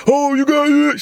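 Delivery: high-pitched mock whine; mocking whiner. Podcaster mimics social media complainers, high mocking voice.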